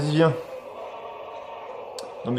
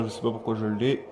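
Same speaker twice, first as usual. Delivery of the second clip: normal talking voice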